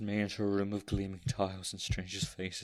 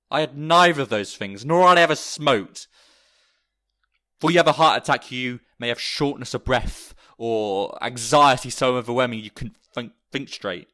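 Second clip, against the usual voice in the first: louder and higher, pushing through rising panic; distressed outburst; 4 semitones higher, 12 dB louder than usual. Acted. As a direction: let the panic spill out loud as the memory hits. Narrator recounting heart attack distress.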